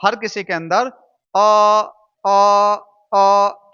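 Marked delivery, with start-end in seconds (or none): 1.3-3.7 अ held loud on a level note; steady, stretched "uhh" for अ; sustained vowel demo. Shown as a demonstration. Teacher sounding out the vowel inside each letter, held vowel. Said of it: "की ध्वनि आ रही है".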